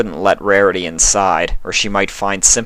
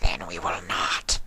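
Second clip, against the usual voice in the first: much softer, breathy whisper; eerie whisper; 2 semitones lower, 10 dB quieter than usual. A narrator's reading. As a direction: whisper it like a creature. Voicing Sympathy.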